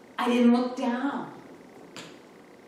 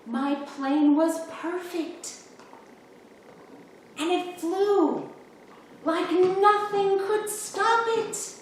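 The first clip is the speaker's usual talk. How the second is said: much higher and louder, bursting with joy; happy excitement; 10 semitones higher, 4 dB louder than usual. Acted. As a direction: let the pride and delight lift the voice way up. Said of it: "I hadn't felt that happy".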